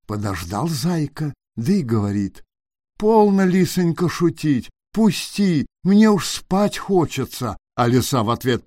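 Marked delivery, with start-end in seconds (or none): 3.0-7.6 higher small voice of a pleading hare; a touch of the timid hare asking the fox. A narrator's reading. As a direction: beg politely to be let in.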